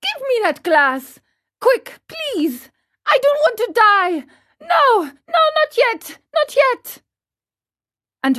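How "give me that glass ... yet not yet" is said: very high, frantic begging; desperate puppet boy wailing; panicked cry. A narrator's reading. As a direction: plead for your life like a terrified child. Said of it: "the marionette cried out".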